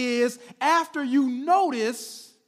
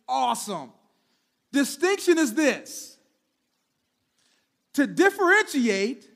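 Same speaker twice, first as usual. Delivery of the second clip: usual speaking voice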